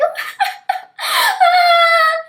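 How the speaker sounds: high, drawn-out mock wail; badly faked crying noise; fake cry. Girl showing how she cried down the path, fake crying. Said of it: "I don't have a very good fake cry".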